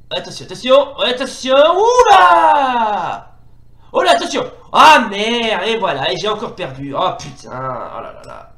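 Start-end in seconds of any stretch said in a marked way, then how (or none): 0.1-3.3 warning rising into a long, loud, high cry; drawn-out gamer yell; alarmed shout. Real, not acted. Alarm as attack lands in game. 3.9-5.7 loud, high warning, then a curse; real frustrated gamer outburst; alarmed outburst. Real, not acted. Losing the fight.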